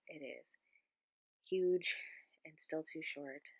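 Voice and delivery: normal talking voice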